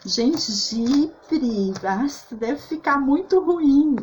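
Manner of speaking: imitating a friend wrinkling her nose at ginger; doubtful friend. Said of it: "E aí ela falou".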